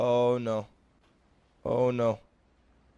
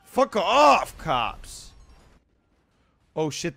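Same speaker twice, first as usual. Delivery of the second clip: ordinary talk, nothing marked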